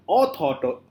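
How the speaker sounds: "author-" drawn out with weight on au; wrong first-syllable stress. Shown as a demonstration. Teacher showing what it is not, misplaced stress. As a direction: lean slowly on the start of "author-". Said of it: "This is not".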